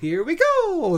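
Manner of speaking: very high, laughing plumber cry; Mario catchphrase. Retold or imitated. Host mimics Mario's catchphrase, very high and laughing. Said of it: "he'd still do".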